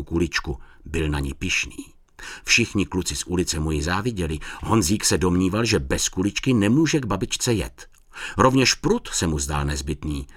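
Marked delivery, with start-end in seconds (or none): none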